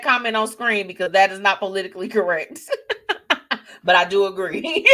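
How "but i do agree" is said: amused agreement. Real, not acted. Host agrees with a risky comment, laughing. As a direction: agree while breaking into laughter.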